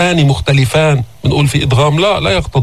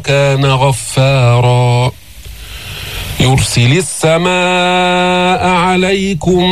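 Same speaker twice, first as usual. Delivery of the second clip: slow, measured tajweed recitation; steady melodic Quranic chant; 2 semitones higher, much slower than usual. Shown as a demonstration. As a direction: chant the verse with long, held vowels.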